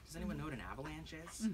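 ordinary delivery

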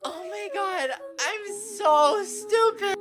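high, soft, slow whimper; tiny high wail of embarrassment; hammed-up despair. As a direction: whimper it in mock self-pity. Acted despair at own stupidity.

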